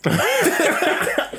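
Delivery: very high cackling laugh; helpless laughter. Real, not acted. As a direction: lose it completely. Big laugh. (fou rire incontrôlable)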